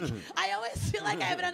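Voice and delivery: usual speaking voice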